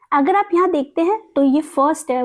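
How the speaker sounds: normal talking voice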